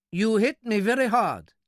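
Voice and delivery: high-pitched, precise complaint; aggrieved little man. A narrator's reading.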